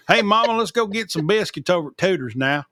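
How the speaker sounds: broad Appalachian twang, words rolled like marbles in the mouth; thick Appalachian accent. Retold or imitated. Host showing how he talks back home, hammed-up Appalachian twang. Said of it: "you talk like you got marbles in your mouth".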